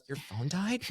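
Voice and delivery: mock the young clerk's dismay at a dead phone; imitating a distraught clerk. Guest imitates the worried hotel clerk, mock distraught. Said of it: "Like, she almost was crying".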